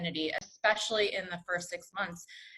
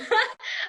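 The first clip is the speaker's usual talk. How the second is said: breathy laugh; 4 dB louder than usual. Real, not acted. Laughing at own vacation.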